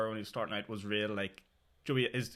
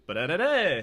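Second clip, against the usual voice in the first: loud, higher sing-out of a punchline; mock your own big punchline with nonsense syllables; punchline delivery; 5 semitones higher, 8 dB louder, slower than usual. Comedian mocking how they hit the punchline, exaggerated delivery. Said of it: "deliver it as if, like".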